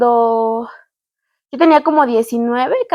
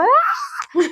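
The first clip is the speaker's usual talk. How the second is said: mock scream; 12 semitones higher, 4 dB quieter than usual. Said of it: "mi miedo es como".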